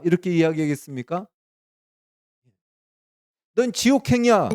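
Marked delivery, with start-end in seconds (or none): 3.6-4.5 high, loud stern pronouncement; God's verdict. Pretend. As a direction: deliver the sentence like a judge from above. God's verdict voiced in sermon. (높고 큰 소리로 엄하게 선고하듯)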